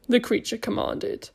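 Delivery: ordinary delivery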